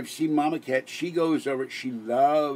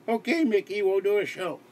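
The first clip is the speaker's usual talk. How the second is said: mock cheerful reply; 9 semitones higher than usual. [name] answers in pretend dialogue.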